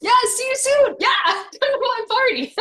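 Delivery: a hammed-up cheery party invite; laughing mock invite. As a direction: tease the fake invite while giggling. Mocking the fake invitation, laughing.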